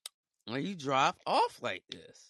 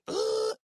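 high-pitched "ugh"; mock huffy grunt. Imitates the customer's reaction.

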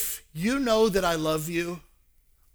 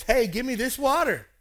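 higher, louder acted eagerness; eager demand; 8 semitones higher, 7 dB louder than usual. Samaritan woman asking for living water, eager.

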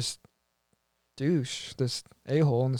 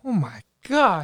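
surprised; 4 semitones higher, slower than usual. Real, not acted. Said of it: "That is so high".

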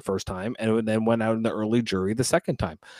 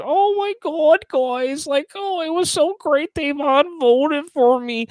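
high, gushing mock of her crying, much higher pitch; mocking weepy gush; 13 semitones higher, slower than usual. Podcaster mocking a houseguest's diary room tears, high gushy parody. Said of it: "she goes and cries in the D R and says".

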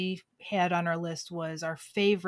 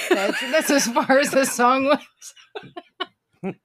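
slow, high, laughing through it; 7 semitones higher, 8 dB louder, much slower than usual. Real, not acted. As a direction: laugh as you say it. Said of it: "repeat, laughing".

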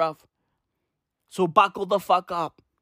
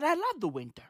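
softer, almost to himself; quiet admission; 5 semitones higher, 10 dB quieter, faster than usual. Real, not acted. Scene: admitting to viewers he loves winter, softly. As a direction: confess it under the breath.

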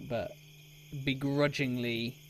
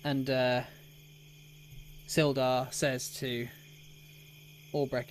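normal talking voice

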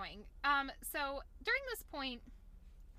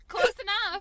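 loud mock shrug; 7 semitones higher, 11 dB louder than usual. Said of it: "he's like".

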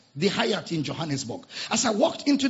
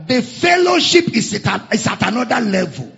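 preaching shout; 4 semitones higher, 9 dB louder than usual. Real, not acted. Preacher proclaiming to congregation.

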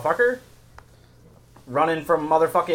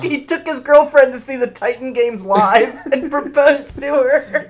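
laughing; 12 semitones higher, 7 dB louder than usual. Real, not acted. Laughing telling crowd proposal.

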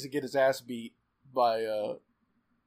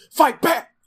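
high, very loud shouted command; sudden high-pitched mock battle cry; imitated yell; 13 dB louder than usual. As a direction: yell it at full volume, imitating her. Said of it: "just yelling at him".

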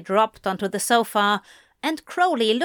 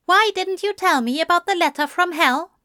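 higher-pitched angel character voice; Aziraphale, questioning; 9 semitones higher, 3 dB louder, faster than usual. A narrator's reading. Angel asking Crowley about the letter from hell, questioning. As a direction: press him with a high-voiced question.

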